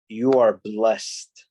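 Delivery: "You are blessed" drawn out very slowly; emphatic. Real, not acted. Teacher impressing it on students, "You are blessed" slow and emphatic. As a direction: give each word of "You are blessed" slow weight. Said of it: "I try to emphasize this".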